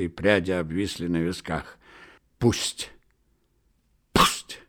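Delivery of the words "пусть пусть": hoarse broken whisper building; a cracked, strained start. A narrator's reading. Elena forcing out her toast, hoarse and broken. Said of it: "надломленно и хрипло крикнула она".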